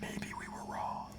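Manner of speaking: whispered aside. Real, not acted. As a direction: breathe it out unvoiced.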